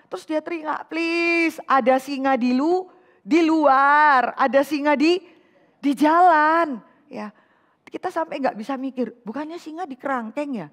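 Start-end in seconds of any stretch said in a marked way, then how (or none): 0.9-6.8 louder and louder panicked cry, slow at the end; mock panicked yell. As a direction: scream the excuse, dragging the last words. Lazy man screaming excuse. (berteriak panik pura-pura, melambat di akhir)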